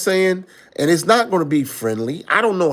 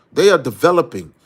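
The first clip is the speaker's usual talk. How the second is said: usual speaking voice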